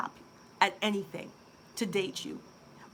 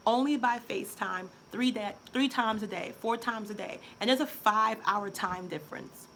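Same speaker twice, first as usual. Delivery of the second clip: ordinary delivery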